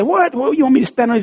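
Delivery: high, startled retort; indignant surprise. Retold or imitated. Old lady asked to stand on the scales, high indignant surprise. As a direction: squeak it out in disbelief. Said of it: "And she said".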